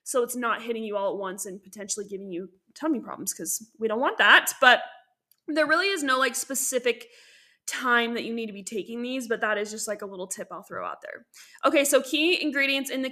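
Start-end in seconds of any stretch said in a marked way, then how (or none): none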